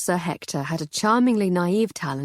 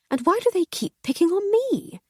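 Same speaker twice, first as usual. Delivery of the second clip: exasperated lady; 14 semitones higher than usual. Acted. Lady Hardcastle mock complaint.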